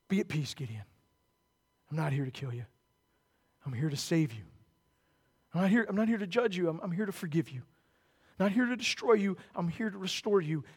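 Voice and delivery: soft, tender voice of God; God quietly comforting a frightened Gideon; gentle reassurance. Acted. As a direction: hush down and comfort him.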